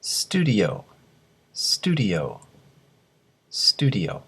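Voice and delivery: スタジオ said as English "studio", first syllable stressed; English pronunciation model. Shown as a demonstration. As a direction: clear teacher's model of スタジオ as native English. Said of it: "よく聞いてください".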